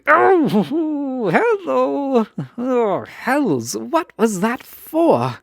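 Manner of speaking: high, loud surprised squeal breaking into a laugh; a flustered, giggly shock at being twirled; startled yelp. Acted. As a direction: caught off guard mid-step, laughing it off. Startled when suddenly spun.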